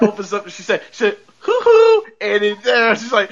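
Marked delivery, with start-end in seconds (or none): 1.4-2.0 high, loud sing-song hoo hoo; a jaunty high "Hoo hoo" calling into the house. Retold or imitated. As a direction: call "Hoo hoo" up high and bright like a neighbour. Missus Hart calls out cheerily.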